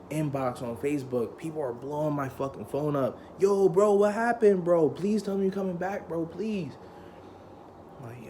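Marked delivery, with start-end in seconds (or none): 3.4-6.7 high, urgent imitated begging; pleading. Schoolmates messaging their expelled friend, pleading. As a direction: plead like worried friends, voice pitched up.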